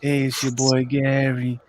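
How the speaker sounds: low, slow Rob impression with a New York accent; imitating Rob. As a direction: lean into a lazy New York buddy greeting. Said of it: "he still got the New York accent".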